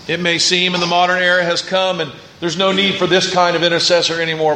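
ordinary delivery

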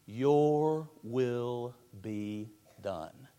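very slow, soft and yielding; a whisper of complete surrender; submissive surrender. Shown as a demonstration. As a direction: let go of your own will as you say it. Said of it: "the perfect submissive spirit".

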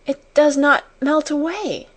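high, mildly breathless-with-wonder exclamation; panted wonder. A narrator's reading. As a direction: say it high, as if overcome. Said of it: "she panted".

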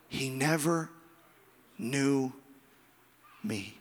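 each word set apart, sinking low; solemn, sobering. Real, not acted. Solemn echo of the judgement.

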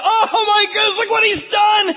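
very high, loud amazed cry; overjoyed shout. Acted. Parents seeing their daughter alive, overjoyed high shouting. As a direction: shout it in astonished joy. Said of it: "the grief is suddenly turned to".

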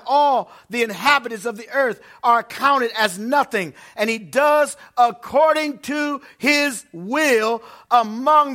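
loud, slow, solemn reading of scripture. Acted. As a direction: proclaim the verses to the congregation. Reads scripture at full force.

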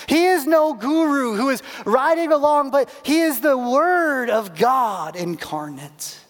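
high, ringing pulpit proclamation; impassioned preaching. Acted. Preacher declaring to the church who Jesus is, impassioned preaching. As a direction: proclaim it with stretched, weighty key words.

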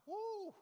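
high, loud "woo" cheer; loud high-pitched celebratory whoop; excited whoop. Retold or imitated. Cheering the new GameCube release.

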